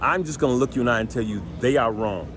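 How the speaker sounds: normal talking voice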